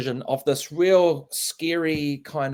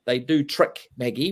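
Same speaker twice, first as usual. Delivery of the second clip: ordinary talk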